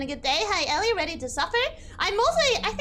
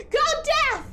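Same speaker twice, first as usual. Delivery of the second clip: high, exasperated exclamation; mock outburst; 10 semitones higher, 4 dB louder than usual. Real, not acted. Streamer snapping back at teasing chat, mock-exasperated high cry. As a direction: burst out at a much higher pitch.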